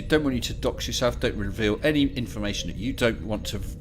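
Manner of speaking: normal talking voice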